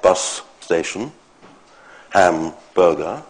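slow "bus station", "hamburger", stressed on BUS and HAM; compound-noun stress. Shown as a demonstration. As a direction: slowly separate both halves of "bus station" and "hamburger", first half stronger. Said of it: "it has the stress pattern of a compound noun".

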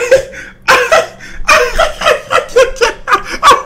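a shrieking laugh of real amusement; high cackling laugh. Real, not acted. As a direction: burst of high, loud laughter. Laughing at the video.

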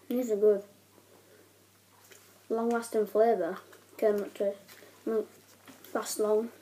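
talking with gum in mouth; chewing between words; chewing gum while talking. Real, not acted. Seen 1: Puts gum in mouth at -12, then jaw and cheeks keep moving.

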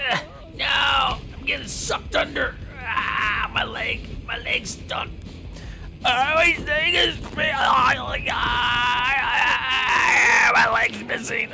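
high, loud panicked cries; frantic high shouting about a stuck leg; panicked yelling. Acted. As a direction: yell like you're being dragged under. Panicked game character stuck.

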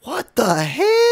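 high, slow, stretched exclamation; mock shock. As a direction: squeal it out in disbelief. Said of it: "All cheekiness aside".

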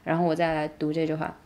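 usual speaking voice

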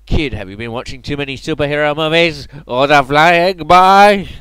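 mock the boy, stretching the end; mocking villain. Cultist mocking the kid.

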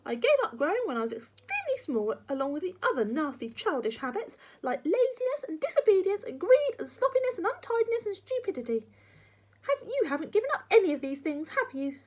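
high, loud crabby granny tirade; nagging old woman in full flow; lecturing grandma. Acted. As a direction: reel off faults accusingly.

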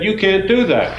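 high, firm retold prohibition; forbidding teacher. Teacher to Karen folding the paper, firmly forbidding. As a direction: shut it down like a strict teacher.

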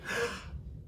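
mock frightened intake of breath; scared gasp. Shown as a demonstration. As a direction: gasp as if seeing something scary. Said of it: "now see something really scary".

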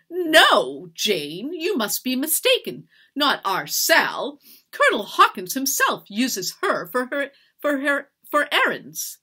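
loud, swooping, exasperated retelling; indignant rant. A narrator's reading. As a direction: protest with haughty outrage. Mistress recounts her humiliation angrily.

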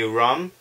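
"rum" for room, with a short vowel; "rum", short vowel replacing the long oo; regional pronunciation. Shown as a demonstration. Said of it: "how some people say rum".

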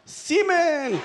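a high, sudden mock yell. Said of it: "I used to just yell out".

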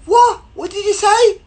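very high, loud put-on voice; startled mock reply. Girl on the train answering a stranger, startled. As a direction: squeal it as a puzzled stranger.

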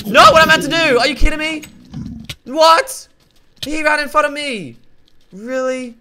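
high, loud outraged yell. Real, not acted. Cheated by the game, outraged yell. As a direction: shout it in disbelief.

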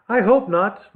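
plain reading voice

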